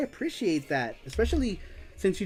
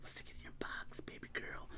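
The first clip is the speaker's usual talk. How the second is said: very soft, breath-only whisper; whispered tease; 21 dB quieter than usual. Pretend. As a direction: whisper it as a playful aside. Teasing repeat of the line, whispered.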